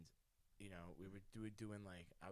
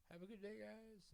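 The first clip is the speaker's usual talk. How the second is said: high, softer breezy farewell; mock cheery goodbye; 11 semitones higher, 9 dB quieter than usual. Leaving past the staring roommates, mock cheery. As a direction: toss it off brightly to cover the awkwardness.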